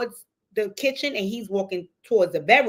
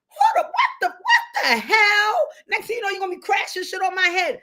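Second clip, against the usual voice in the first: startled outburst; 10 semitones higher than usual. Real, not acted. Reacting to a real crash during the video, startled and laughing. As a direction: jump as something crashes nearby.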